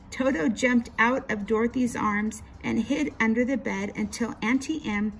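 plain reading voice

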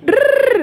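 high rolled trilling noise; playful high "drrr" sound effect; imitated trill. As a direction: trill it like a toy motor. Toy play, imitated noise.

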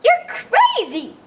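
very high, loud, slow shout; exclaimed. A narrator's reading. Jukebox answering the giant, very high loud slow shout. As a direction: fling it out in disbelief. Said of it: "the jukebox exclaimed".

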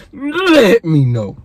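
hammed-up slow outro; drawn-out sign-off. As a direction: drag out each word playfully.

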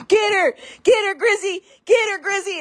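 high, loud egging-on chant; cheering crowd. Retold or imitated. Kitchen coworkers at the cell door, high cheering. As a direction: cheer her on from the doorway.

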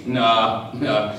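low, unimpressed group verdict; dismissive boys. Retold or imitated. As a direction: brush it off in a low voice. Boys reject a lie.

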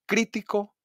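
"crítico" punched on first syllable CRÍ; stress on CRÍ. Shown as a demonstration. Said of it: "hago un golpe de voz". ("crítico" con golpe de voz en CRÍ)

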